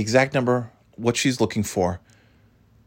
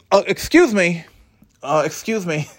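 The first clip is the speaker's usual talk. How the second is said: imitating the driver; 8 semitones higher than usual. Narrator's corrected version of the delivery woman's voice. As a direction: copy her timid excuse me. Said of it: "She sounded more like this".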